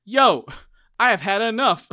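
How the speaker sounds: hammed-up exasperated shout; mock fed-up yell. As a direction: burst in sick of it all. [name] barges in, fed up.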